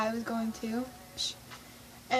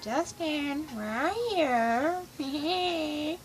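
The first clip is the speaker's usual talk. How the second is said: very drawn-out, singsong calling; longing fangirl call; 3 semitones higher, much slower than usual. Retold or imitated. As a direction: call for your idol, stretching every word. Face against glass, calling Justin.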